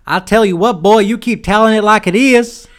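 high, loud hammed-up good ol' boy. A conservative fan cheering the ranting host.

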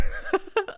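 breathless high giggle; high squeaky laugh. Real, not acted. Picturing the giant cat, high giggle.

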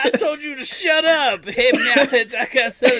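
loud, high hammed-up rant; mock bellhop yelling. Imitating the bellhop yelling at the old gentleman, high loud shout. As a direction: yell it like a cranky bellhop scolding someone. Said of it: "the bellhop guy who keeps yelling".

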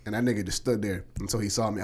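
normal talking voice

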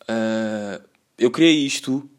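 ordinary talk

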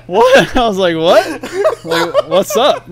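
high, loud, laughing through the question; laughing confused what. Retold or imitated. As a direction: giggle through your own disbelief. Retelling his own confusion, laughing.

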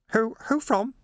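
high, nervous question; frightened stammer. A narrator's reading. Clerk being handcuffed for his protection, high nervous stammer.

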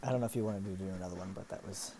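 fast, hushed whisper. Real, not acted. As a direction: whisper it quickly. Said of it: "Sorry, you're whispering again".

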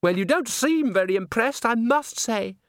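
put-on affected young diva. A narrator's reading. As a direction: play a put-out, high-pitched chatterbox. Christine chatters at Agnes, gushing character voice.

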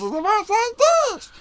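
squeaky helium-high voice; cartoon chipmunk helium voice. Shown as a demonstration. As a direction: sound like you just sucked helium. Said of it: "causes your vocal cords to loosen".

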